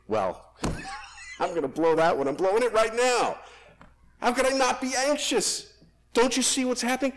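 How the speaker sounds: hammed-up anxious listener; mock anxious protest. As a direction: play the panicky listener who can't stop worrying. Preacher voices anxious listener.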